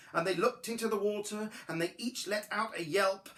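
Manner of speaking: ordinary delivery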